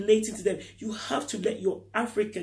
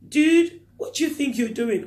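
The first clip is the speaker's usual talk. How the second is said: loud, high scoffing jeer on Dude; mock peer taunt; 6 semitones higher, 6 dB louder than usual. Schoolmates jeering at the African child, loud mock taunt. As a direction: play a schoolmate mocking him. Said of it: "Would they be looked upon like".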